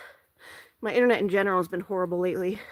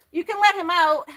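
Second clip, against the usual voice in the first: raised voice to someone across house; calling out; 7 semitones higher, 6 dB louder than usual. Real, not acted. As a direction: call out loud to the next room. Calling out to mother elsewhere.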